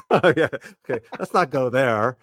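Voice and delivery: normal talking voice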